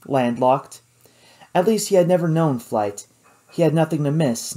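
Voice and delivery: plain reading voice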